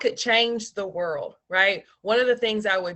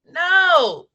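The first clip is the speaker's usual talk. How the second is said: loud lazy jock answer; football player voice; 8 semitones higher, 8 dB louder than usual. Retold or imitated. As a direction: shout it like a dim linebacker. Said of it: "That's my football player voice".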